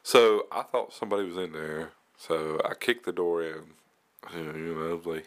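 ordinary talk, nothing marked

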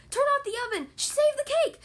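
high, loud panicked cry; panicked shout. Acted. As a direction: yell it in alarm. Monkeys panicking.

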